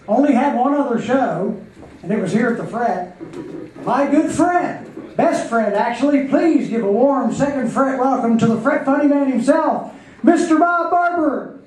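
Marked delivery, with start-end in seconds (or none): 3.8-11.6 big, rising emcee build-up, higher and louder; emcee introduction. Real, not acted. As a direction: hype the crowd for the next act. Host introducing comedian on stage.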